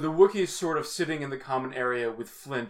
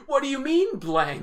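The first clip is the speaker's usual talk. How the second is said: mock improv voice; 11 semitones higher, 4 dB louder than usual. Shown as a demonstration. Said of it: "the worst yes and exercises".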